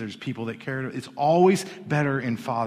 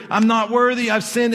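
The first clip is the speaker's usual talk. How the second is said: high, loud, urgent pleading; groveling confession; 9 semitones higher, 9 dB louder than usual. Acted. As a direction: blurt out the rehearsed apology, pitched way up. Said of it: "The son starts saying his story".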